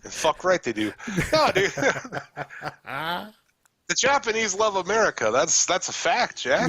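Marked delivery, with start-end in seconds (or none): 1.3-6.7 excited. Acted. Mock patriotic insistence.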